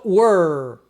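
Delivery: "were" with a long curled-back R; slow demo of "were", R dragged out; pulled R. Shown as a demonstration. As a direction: stretch the R in "were" slowly. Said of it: "O R puxado".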